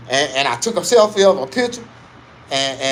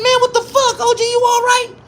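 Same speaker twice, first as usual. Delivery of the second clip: high worried call; 5 dB louder than usual. Retold or imitated. Checking on sweaty OG.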